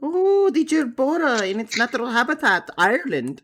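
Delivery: high, hushed wildlife narrator; nature documentary. Pretend.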